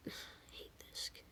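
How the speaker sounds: very soft, voiceless teacher's whisper; whisper under breath. Retold or imitated. Teacher about the student she dislikes, whispered under breath. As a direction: imitate a teacher whispering to herself. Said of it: "heard her whisper under her breath".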